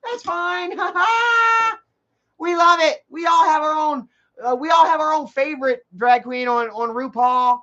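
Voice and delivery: high, breathy gushing lefty voice; mock liberal friends cooing over drag queens. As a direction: parody cheerful liberal approval. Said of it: "all my lefty liberal friends were like".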